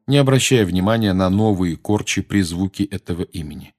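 usual speaking voice

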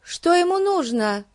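high, ringing question; calling from upstairs. Acted. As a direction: call down in a high-pitched voice. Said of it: "крикнула она Фати сверху".

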